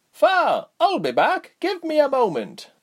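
high, loud called reply; young man calling back. A narrator's reading. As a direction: call back over the noise, high-pitched. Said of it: "he called back".

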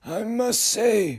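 high, very slow uncanny voice; otherworldly figure, measured; eerie high voice. Acted. Frederick speaks.

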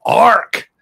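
put-on loud, high old-timer's bark; imitated grandad's shout for quiet; old granddad hushing. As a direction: bellow it like a cross old grandad. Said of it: "to tell you to be quiet, he'd go".

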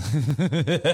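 stifled snicker demo; put-on suppressed giggle; mild laughing. Shown as a demonstration. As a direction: try to hold the laugh in. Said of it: "like, a mild laughing".

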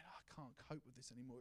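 whispered, soft despair. Retold or imitated. Jesus despairing alone in the garden, whispered. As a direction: breathe it out in a broken whisper.

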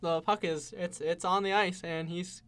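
loud, excited play-by-play call; frantic mock sports commentary. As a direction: call it like a hyped announcer. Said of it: "I'll play-by-play now".

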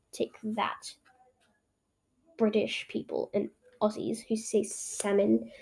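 hushed, low mock-villain mutter; evil plotting. Plotting against British people and Aussies, hushed mock-evil. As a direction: gloat very softly like a schemer. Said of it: "plotting my evil plan".

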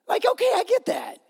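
high mock shrug; mock concession. Pastor mocking a critic's comment, high mock concession. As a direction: squeak it out in mock agreement.

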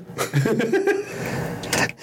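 a short airy giggle; quick amused breathy laugh. Real, not acted. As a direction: laugh softly at the silliness. Laughing about the heist plot.

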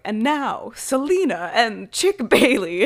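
hammed-up announcer introducing the singers; stage announcer. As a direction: announce the act like a showman at a club. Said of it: "the announcer is like".

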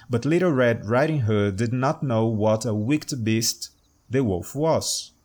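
usual speaking voice

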